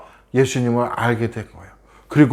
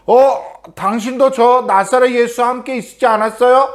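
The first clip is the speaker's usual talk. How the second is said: much higher woman's voice, questioning; accusing woman; 10 semitones higher than usual. Acted. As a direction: point him out, suspicious. (베드로를 알아본 여인, 높은 목소리로 의심하며)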